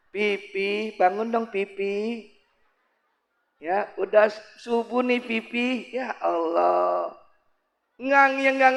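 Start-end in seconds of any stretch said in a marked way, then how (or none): 0.1-7.1 soft, coaxing wife; tender wake-up call. Acted. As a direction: gently coo to a sleeping husband. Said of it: "emang begitu". (istri membangunkan suami dengan lembut)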